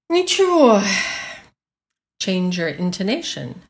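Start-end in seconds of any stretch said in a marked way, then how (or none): 0.1-0.8 Ничего as a resigned, sighing answer; high, loud sigh of resignation falling away. Shown as a demonstration. Teacher demonstrates Ничего as meaning muddling through, resigned. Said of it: "in a tone of resignation".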